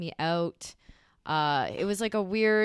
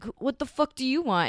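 higher-pitched indignant retort; retold indignant challenge; 7 semitones higher than usual. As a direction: replay snapping at an unwanted approach, pitched up. Retold confronting the man who yelled.

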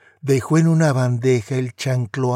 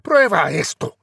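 bellow; 5 semitones higher, 6 dB louder than usual. Acted. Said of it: "bramó Su Alteza".

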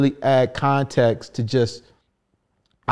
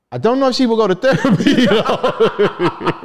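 laughing; 10 semitones higher, 5 dB louder than usual. Real, not acted. Laughs about mom skipping therapy.

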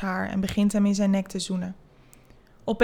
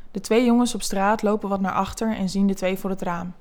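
usual speaking voice

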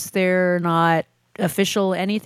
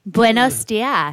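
slow Spanish buenos dias, rolled and clean; good Spanish accent; much slower than usual. Pretend. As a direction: say Buenos dias like a native speaker. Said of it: "Your accent's too good".

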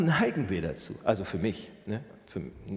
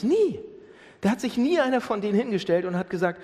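high, loud, driving sermon voice; rising pulpit intensity; forceful preaching; 7 semitones higher, 4 dB louder than usual. Acted. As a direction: preach it to the back row. Preacher building to full force.

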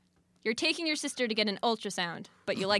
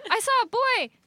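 high mock excited text; 7 dB louder, faster than usual. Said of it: "she texts us saying".

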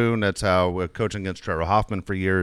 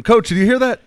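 higher, louder player's shout to coach; calling out; 14 semitones higher, 9 dB louder than usual. Retold or imitated. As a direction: imitate a kid calling for the coach. Player calls out to his coach.

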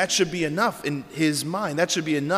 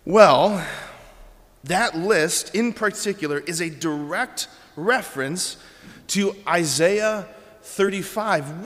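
ordinary delivery

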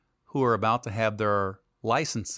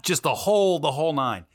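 ordinary talk, nothing marked

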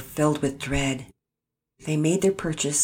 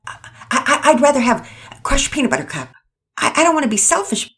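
flustered stammer; 9 semitones higher, 6 dB louder, faster than usual. Acted. Pearson torn over flavor choice.

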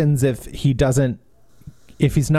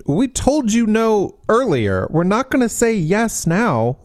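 high, raised exasperated retort; exasperated boss; 8 semitones higher than usual. Retold or imitated. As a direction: scold them impatiently.

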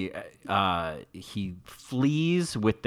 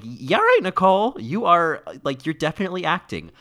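higher and louder, teasing disbelief; mock call-out; 9 semitones higher, 7 dB louder than usual. Talking back at Nicole Kidman on screen, mock accusing. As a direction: needle her like a fan calling her bluff. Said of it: "There's some moments where I am like".

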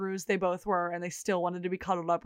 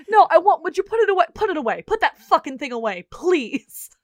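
flustered yelling; 7 semitones higher, 7 dB louder than usual. Retold or imitated. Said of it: "He's like".

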